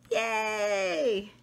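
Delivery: plain reading voice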